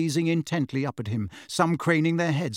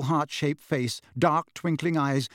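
ordinary delivery, nothing marked

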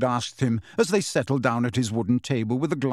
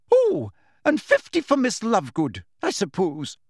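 high-pitched professor, tossing in an afterthought; brisk schoolmistress, pitched up then dropping; 8 semitones higher than usual. A narrator's reading. Professor adding points as an afterthought, briskly. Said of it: "Professor McGonagall continued briskly".

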